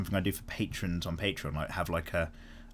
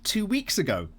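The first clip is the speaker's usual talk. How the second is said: usual speaking voice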